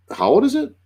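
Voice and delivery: high-pitched retold question; wary question. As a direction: ask it warily, voice lifted high. Acts his wary reply to owner.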